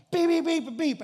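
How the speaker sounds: high, loud bleeps fading down; mock beeping. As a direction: imitate a beeping sound, starting high. Guy mocking from window.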